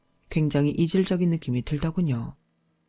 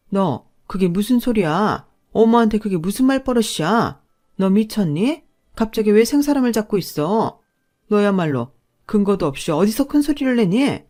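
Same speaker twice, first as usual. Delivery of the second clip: mother scolding her son, loud and higher; indignant mother snapping back; angry retort; 5 semitones higher, 7 dB louder than usual. A narrator's reading. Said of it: "똑같이 화를 내셨죠". (아들에게 맞받아치는 시어머니, 화난 목소리)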